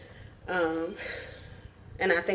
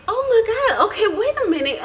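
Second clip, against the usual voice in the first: high laughing alarmed grandma; flustered, laughing, a bit alarmed; 13 semitones higher than usual. Retold or imitated. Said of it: "Grandma Holla was like".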